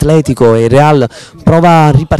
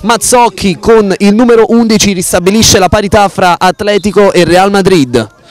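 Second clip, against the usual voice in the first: breathless italian goal commentary; 7 semitones higher than usual. Real, not acted. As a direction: belt out the goal. Goal call, live play-by-play.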